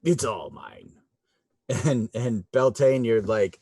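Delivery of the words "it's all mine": low possessive. Acted. Fergus claims the alcohol.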